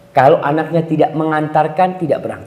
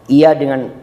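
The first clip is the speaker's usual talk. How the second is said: normal talking voice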